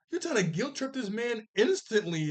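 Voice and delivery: ordinary talk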